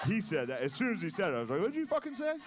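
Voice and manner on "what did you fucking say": high, startled indignant snap; a shocked son calling his dad out; mock outrage. Son scolding his dad over the joke, high mock outrage.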